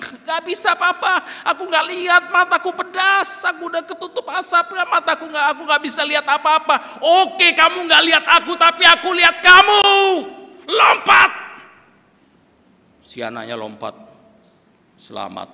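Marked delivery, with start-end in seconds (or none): 0.0-7.0 high, loud, panicked wailing; frightened child. Acted. Child blinded by smoke answering father, panicked shouting. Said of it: "si anak bilang". 7.0-11.5 very loud, high yelling; full-force urging. Acted. Father shouting to blinded child to jump, full-force urging.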